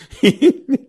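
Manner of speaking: high, loud burst of laughter. Real, not acted. Preacher laughing at his own funny story, a high real laugh.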